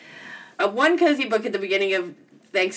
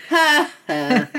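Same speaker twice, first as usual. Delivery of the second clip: witchy cackle. Real, not acted. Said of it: "Do I sound like a witch when I laugh".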